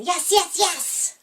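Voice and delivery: high, squeaky little-bunny cheer; baby rabbits cheering. Acted. Rabbit's babies joining in the party cheer, high little voices. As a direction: chirp it like tiny excited bunnies. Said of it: "Her babies joined in".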